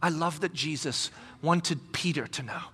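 ordinary talk, nothing marked

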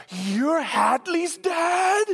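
high, slow, delighted cry; thrilled young counselor meeting a hero's dad; starstruck squeal. Retold or imitated. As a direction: gush like a starstruck fan.

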